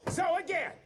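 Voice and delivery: high, shouting tirade at the team; furious rant. Acted. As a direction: tear into them, yelling. Furious boss chews out staff.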